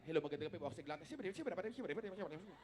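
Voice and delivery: hint of a rushed rapper mumbling. Singer imitates his fast rapping friend, softly. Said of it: "kala mo may lakad".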